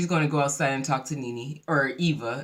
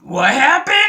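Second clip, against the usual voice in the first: loud, hammed-up grand entrance voice; exaggerated imitation of a dignified woman's booming question; Marlo; 8 semitones higher, 10 dB louder than usual. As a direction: boom it out with mock dignity, pitched up at the end. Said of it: "her masculine, dignified voice".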